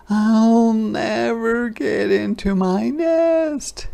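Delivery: high, slow despairing little voice; high slow despairing hedgehog. A narrator's reading. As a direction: moan it as a tiny hedgehog.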